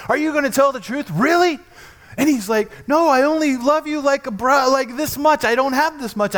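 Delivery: loud, high, driving sermon voice; fiery preaching. Acted. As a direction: preach it out full force. Preaching at full force.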